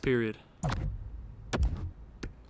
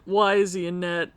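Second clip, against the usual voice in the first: ordinary talk, nothing marked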